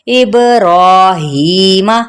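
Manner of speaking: इब्राहीमा slowly, with long रा and ही; one-alif stretch. Shown as a demonstration. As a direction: hold रा and ही, keep the final मा short. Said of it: "एक अलिफ के बराबर ही खींचा जाएगा". (रा और ही को खींचें, मा छोटा)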